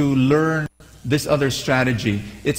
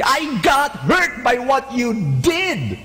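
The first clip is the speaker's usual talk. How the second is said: higher, slow, accusing tone; angry way; 8 semitones higher, slower than usual. Shown as a demonstration. Angry words to a difficult person. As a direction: let the hurt come out as an attack. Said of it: "if you say it in an angry way".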